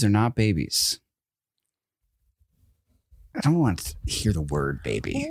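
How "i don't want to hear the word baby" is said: low, quiet deadpan; comically grave low declaration; deadpan vow. Real, not acted. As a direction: drop it low and soft, like a threat. Comic vow after pause.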